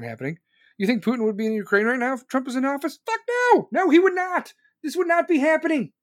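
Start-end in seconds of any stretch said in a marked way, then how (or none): 3.1-5.9 much higher, louder yelling; furious shout. Real, not acted. Ranting at viewers about Putin, furious shout. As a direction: yell it at the top of your voice.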